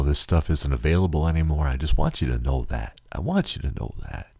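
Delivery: ordinary talk, nothing marked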